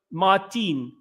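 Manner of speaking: put-on "Martin" said the Swedish way, ending in "teen"; a Swede calling out "Martin" with a Swedish lilt; Swedish accent. A Swedish stranger hails Martin in the street, Swedish accent. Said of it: "If a Swedish person comes up to Martin".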